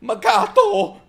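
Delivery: high-pitched melting aww; squeaky fond gushing; cooing. Real, not acted. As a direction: coo over something adorable. Cooing at a cute scene.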